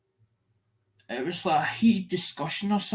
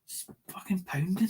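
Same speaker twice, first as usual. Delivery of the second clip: hushed, half-whispered complaint; retold complaint; 3 semitones higher, 4 dB quieter than usual. Man telling a friend his head is pounding, hushed. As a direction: breathe it out like your head hurts. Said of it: "I says".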